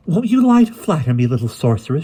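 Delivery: high, loud, theatrical witch's question; taunting inquiry. A narrator's reading. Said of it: "she inquired".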